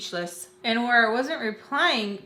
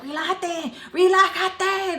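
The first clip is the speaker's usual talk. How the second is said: imitated mad scolding; 7 semitones higher, slower than usual. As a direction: imitate her getting mad, pitched up. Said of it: "getting mad at me".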